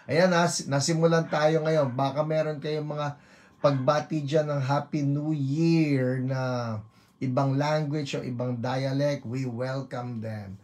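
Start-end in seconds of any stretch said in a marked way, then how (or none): none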